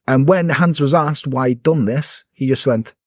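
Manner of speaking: ordinary talk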